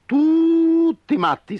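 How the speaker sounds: high, slow, loud hoot-like cry; shouting owl. Acted. Owl yells that everyone is crazy, loud high cry. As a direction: shout them all down. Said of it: "gridò Gufo".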